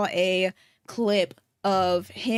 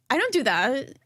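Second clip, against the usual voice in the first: higher, rising end on that; Trisha's way of saying it; 5 semitones higher than usual. Retold or imitated. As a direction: copy her rising I don't do that. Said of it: "But the way that she's, she says it is".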